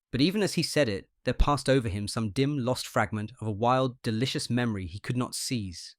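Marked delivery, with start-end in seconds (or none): none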